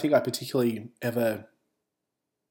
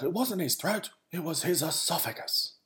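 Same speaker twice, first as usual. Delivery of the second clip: mildly indignant mum, pitched higher; retold angry correction; 6 semitones higher, 4 dB quieter than usual. Mum insisting on esophagus over throat, mock angry. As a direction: imitate mum correcting you crossly, without raising volume. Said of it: "she gets really angry".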